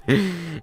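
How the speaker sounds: high burst of laughter. Real, not acted. Telling viewers who left the room, bursting into high laughter. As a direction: crack up at the reveal.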